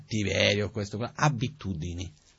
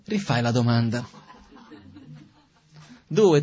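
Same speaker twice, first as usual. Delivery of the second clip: normal talking voice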